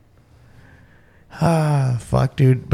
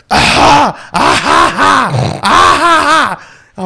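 high, loud cackling laugh imitated; kid's real laugh; 11 semitones higher, 6 dB louder, much slower than usual. Dad imitating his kid's real laugh, high and loud. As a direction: mimic a shrill hysterical kid laugh. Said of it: "never heard him actually laugh".

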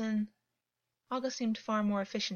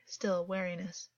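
ordinary delivery

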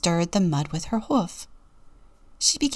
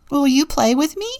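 hopeful; 6 semitones higher, 4 dB louder, faster than usual. A narrator's reading. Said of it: "Ava asked hopefully".

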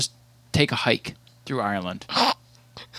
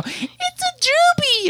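much higher, slow mock squeal; high, drawn-out imitation of a fan; imitating her squeal; much slower than usual. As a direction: copy her smitten high coo. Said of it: "she'll just go".

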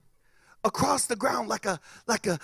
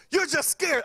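higher-pitched needling voice; mock taunting; 8 semitones higher than usual. Friend taunting the scared kid, higher mocking voice. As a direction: tease him with the accusation.

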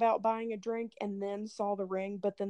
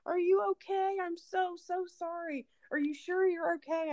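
worried apologetic guy; 8 semitones higher than usual. Retold or imitated. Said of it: "And he was like".

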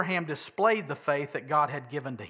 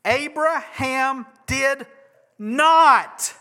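insistent; 7 semitones higher, 8 dB louder, slower than usual. Real, not acted. Preacher insisting on key point.

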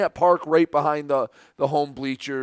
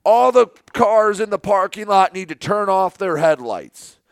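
higher, louder get-off-my-lawn announcement; mock a cranky dad official on the mic; angry dad; 5 semitones higher, 5 dB louder than usual. Official ordering headlights off over the PA, angry dad voice. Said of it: "angry dad, get off my lawn voice".

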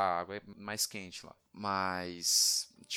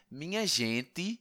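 drawn-out Northeastern "minha gente", higher pitched; imitated Northeastern accent; 6 semitones higher, much slower than usual. Quoting what locals say, slow imitated Northeastern accent. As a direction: stretch it like a Northeasterner. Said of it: "Eles falam assim".

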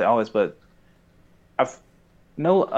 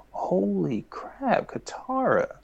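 high, slow, softer gasp of disbelief; stunned disbelief; 10 semitones higher, 5 dB quieter, much slower than usual. Real, not acted. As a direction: drag out the shock, hushed. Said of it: "shocked by Katara's line".

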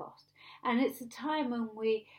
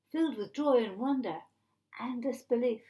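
ordinary delivery

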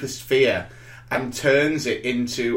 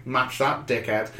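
smug taunt; 4 semitones lower, 7 dB quieter than usual. Retold or imitated. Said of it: "looking over at Tom King going".

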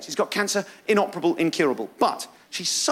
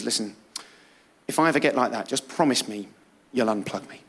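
solemn dad; 9 semitones lower than usual. Retold or imitated. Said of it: "He says".

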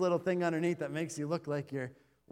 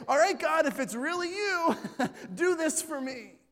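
eager plea; 6 semitones higher, 4 dB louder than usual. Retold or imitated. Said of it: "And you say".

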